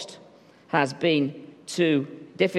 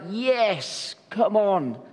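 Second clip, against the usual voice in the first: higher, gushing eager followers; eager disciples; 5 semitones higher than usual. Retold or imitated. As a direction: gush with keen devotion. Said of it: "And they're like".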